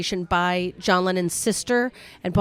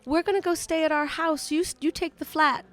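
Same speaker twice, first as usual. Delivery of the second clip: lightly imitated English woman, pitched up; club owner's wife; 6 semitones higher than usual. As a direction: voice a generous hostess, higher. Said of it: "she goes".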